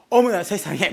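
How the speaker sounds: mock gasp. Aunties shocked.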